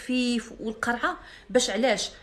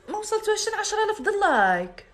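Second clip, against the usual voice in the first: mocking whine; 10 semitones higher than usual. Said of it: "mocking live girls' complaint".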